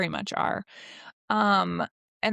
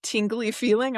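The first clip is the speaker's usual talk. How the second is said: normal talking voice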